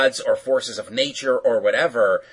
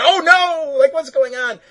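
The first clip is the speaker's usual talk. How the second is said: high, loud cartoon alarm; mock panic; 6 dB louder than usual. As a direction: cry out in hammed-up dismay. Voices a character's alarm in retelling.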